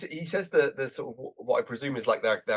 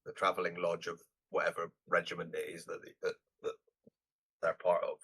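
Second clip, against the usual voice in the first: lower, softer, offhand voice for the man; low character voice; 6 semitones lower, 8 dB quieter than usual. Retold or imitated. Retelling the film, the man names his lodge in a low, offhand voice. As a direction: drop low and mumble it offhand.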